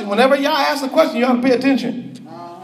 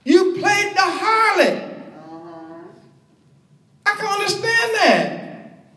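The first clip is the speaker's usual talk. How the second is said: higher-pitched, aggrieved listing in short phrases; wronged husband; 4 semitones higher than usual. Acted. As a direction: recount your side like a betrayed husband. Preacher voices Yah as betrayed husband.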